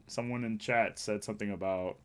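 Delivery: usual speaking voice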